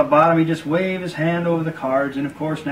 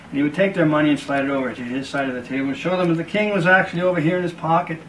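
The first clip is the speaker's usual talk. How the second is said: normal talking voice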